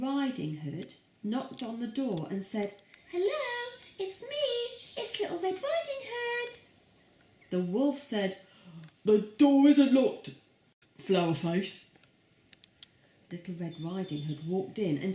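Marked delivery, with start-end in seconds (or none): 3.1-6.6 put-on much higher, sweet little girl. Girl voiced in the story. 9.0-11.9 louder sly wolf; a loud, sly wolf in disguise. Acted. Wolf voiced in the story.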